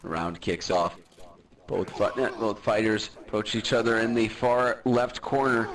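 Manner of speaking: low, steady sports commentary; play-by-play commentary. Acted. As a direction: call the fight as it happens. Live fight commentary.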